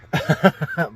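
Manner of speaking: loud burst of laughter. Real, not acted. Vlogger laughing at his own bit, loud laugh. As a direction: laugh out at your own joke.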